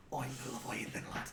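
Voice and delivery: whispered, menacing sea-dog boast. Acted. Captain whispering his boast to the party, menacing whisper. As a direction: breathe it like a dark secret.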